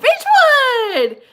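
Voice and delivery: high, drawn-out excited cry; light up at a town you know. Real, not acted.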